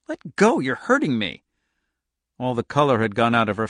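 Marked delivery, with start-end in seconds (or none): 0.1-1.4 high, pleading cry starting sharp; frightened, hurting plea; pained protest. A narrator's reading. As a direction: plead to be released, high at first. Waitress held by the wrist, hurting.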